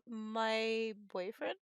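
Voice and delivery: normal talking voice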